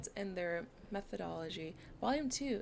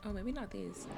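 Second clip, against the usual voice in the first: usual speaking voice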